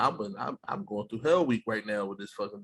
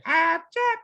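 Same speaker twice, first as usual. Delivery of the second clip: much higher, louder mock Japanese accent; 4 dB louder than usual. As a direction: retell the high school nickname in a put-on Japanese accent. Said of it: "we said it in a real Japanese voice".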